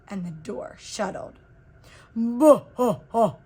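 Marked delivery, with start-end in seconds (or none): 2.2-3.3 loud mocking bah ha ha; monster laugh. A narrator's reading. Monster laughs loudly at the fearless girl. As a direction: laugh to scare the child.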